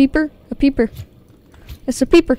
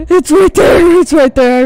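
loud, high frightened gasp and cry; panicked scream; 4 semitones higher, 9 dB louder than usual. Real, not acted. Gamer sees the monster up close, shrieks in terror. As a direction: yell in sudden terror. Leviathan suddenly appears.

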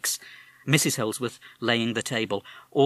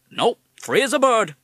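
put-on higher, louder parrot with a tough American accent; 13 semitones higher, 10 dB louder than usual. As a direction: talk like a fast-talking American wise guy. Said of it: "You have an American accent".

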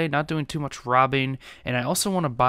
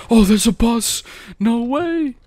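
high, loud shout of disbelief; panicked yell; 10 semitones higher, 7 dB louder, slower than usual. Real, not acted. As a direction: cry out as disaster looms. Bus appears during chase.

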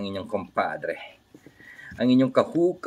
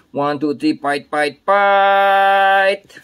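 rising count into a long loud "fight"; high, drawn-out shout; mock fight call; 9 semitones higher, 7 dB louder, much slower than usual. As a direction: call the fight, holding the last word.

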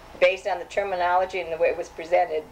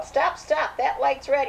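put-on child's scream; 8 semitones higher, slower than usual. Four-year-old yells at his mother driving through a light, high and alarmed. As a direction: cry out like a scared little boy on a car ride. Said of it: "he screamed out".

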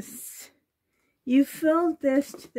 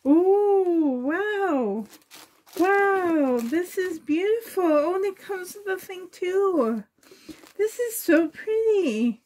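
higher, tearful joy at each gift; real emotional delight, pitched up; thrilled gushing; 6 semitones higher than usual. Real, not acted. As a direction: gush over the present, close to tears.

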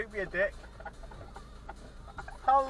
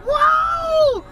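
high shocked yelp; startled shout; 8 dB louder than usual. Real, not acted. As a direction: yelp in surprise. Startled again.

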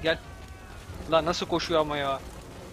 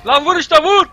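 very loud high yell; exasperated shout; 11 semitones higher, 14 dB louder, slower than usual. Real, not acted. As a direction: blast it at the screen.

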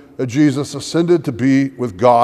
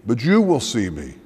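measured, louder formal Gospel reading; scripture reading; 5 dB louder than usual. Acted. Pastor reading the Gospel to the congregation, formal proclamation. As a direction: proclaim each phrase to the congregation. Reads the Gospel passage aloud.

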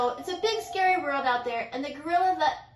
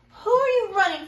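high, loud curious voice; chipmunk's question; 7 semitones higher, 8 dB louder, faster than usual. A narrator's reading. Chipmunk asking the running mouse. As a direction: chirp it out. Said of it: "asked the chipmunk".

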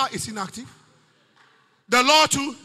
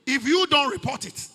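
usual speaking voice

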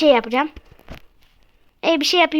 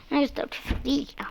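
hushed whispered denial; whispering; 11 dB quieter than usual. Real, not acted. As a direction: whisper it under your breath. Whispered aside.

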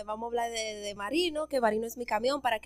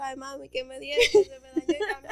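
mock wailing to mom; 3 semitones higher, 5 dB louder than usual. Tells mom the truck broke, in mock wailing. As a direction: whine it like a crying kid on the phone. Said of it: "Y yo".

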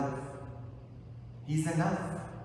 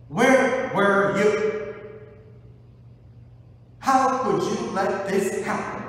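loud anguished accusation; 8 semitones higher, 8 dB louder than usual. Acted. Grieving sisters demanding why Jesus came late, anguished. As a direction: accuse him through grief.

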